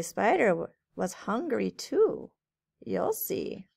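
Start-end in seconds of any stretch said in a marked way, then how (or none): none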